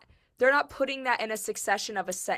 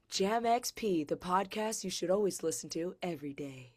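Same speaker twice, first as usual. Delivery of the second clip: sultry radio voice; 3 semitones lower, 7 dB quieter than usual. Pretend. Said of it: "that sultry radio voice now".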